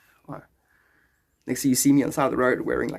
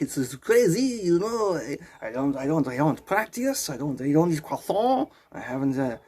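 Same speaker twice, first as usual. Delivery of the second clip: hammed-up French accent with swinging tune. Border visitor explains his sudden change on stepping into Quebec, French accent. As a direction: slip helplessly into a comic French accent. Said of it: "took on a French accent".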